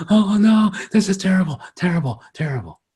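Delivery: hammed-up mourner's lament; mock despair. Mourners at a funeral, mock wailing despair. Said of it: "everybody else is going".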